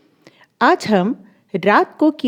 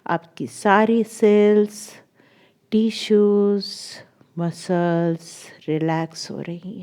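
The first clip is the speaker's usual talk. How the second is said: guided meditation; 4 dB quieter than usual. Acted. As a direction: lull the listener into releasing each muscle, with long pauses. Leads a guided body relaxation. (धीमे, खींचे हुए शब्दों में शरीर को शिथिल कराती आवाज़)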